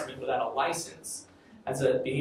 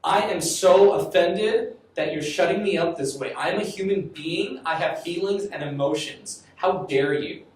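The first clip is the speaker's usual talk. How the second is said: slower and louder, acting the boy's outrage; indignant boy; 4 semitones higher, 6 dB louder, slower than usual. The boy protests to his therapist, indignant. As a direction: imitate an offended boy demanding respect. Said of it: "You know what he said?".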